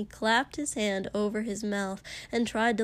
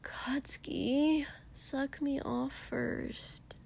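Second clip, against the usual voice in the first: whispering; 4 semitones higher, 6 dB quieter, slower than usual. Acted. Denki pleads breathily.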